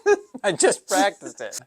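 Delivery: embarrassed laughing; laughing at himself. Real, not acted. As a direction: laugh through the words. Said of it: "Dang it, I still missed my".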